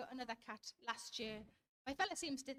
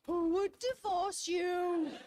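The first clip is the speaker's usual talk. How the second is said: slow, much louder, exaggerated pity; mock sympathy; 4 semitones higher, 9 dB louder, much slower than usual. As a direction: ham up a pitying coo. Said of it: "It's like somebody saying to me".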